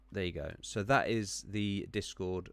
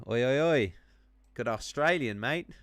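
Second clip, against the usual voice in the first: loud, higher mock Australian accent; 4 semitones higher, 8 dB louder than usual. Streamer greeting an Australian-born viewer, playful Australian accent. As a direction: put on a cheery cartoon Australian accent. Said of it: "I'm not too good at the Australian accent".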